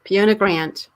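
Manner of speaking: British speaker putting on American; imitated American accent. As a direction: echo the name with an American accent. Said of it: "look at that American accent coming through".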